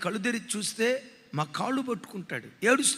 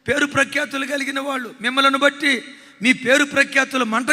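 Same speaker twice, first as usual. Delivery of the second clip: higher and louder, desperate son; anguished confession; 4 semitones higher, 7 dB louder than usual. Retold or imitated. Son at his father's feet confessing despair, raised and anguished. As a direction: pour out shame and despair.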